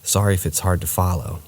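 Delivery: plain reading voice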